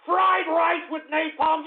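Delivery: high, slow yell on FRIED rice with napalm; indignant, drawn-out yell; outraged repeat. Real, not acted. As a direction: throw the words back in outrage. Outraged repeat of the troll's name.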